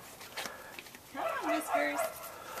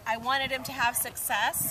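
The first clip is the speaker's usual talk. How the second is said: normal talking voice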